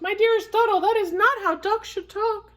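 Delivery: high, shocked mother-goose voice; honking goose. A narrator's reading. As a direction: scold in a high honky voice. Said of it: "She honked".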